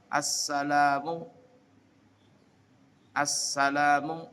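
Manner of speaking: "Assalamu" slowly with the s doubled; slow, double s. Shown as a demonstration. Teacher slowly demonstrating "Assalamu" to students. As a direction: stretch the ss in "Assalamu". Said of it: "S-nya dua".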